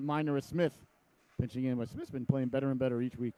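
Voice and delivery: ordinary talk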